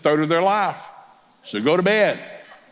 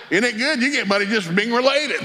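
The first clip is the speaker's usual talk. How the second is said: mock delight; 9 semitones higher than usual. Teasing his daughter about her scholarship.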